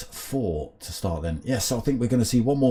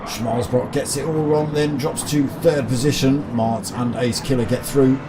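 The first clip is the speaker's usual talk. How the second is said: motorsport commentator; 3 semitones higher, 4 dB louder than usual. Acted. Live sim race commentary.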